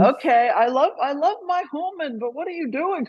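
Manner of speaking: high, chirpy talking dog voice; animal voice. Acted. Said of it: "sorry, that's my animal voice".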